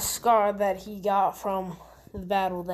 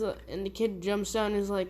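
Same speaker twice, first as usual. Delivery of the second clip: plain reading voice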